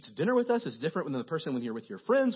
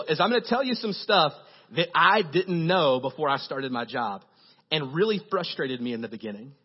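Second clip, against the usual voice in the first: usual speaking voice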